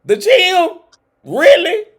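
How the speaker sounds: high, loud disbelief; outraged shout. Real, not acted. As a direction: yell it in total disbelief. Outraged rant about gym on list.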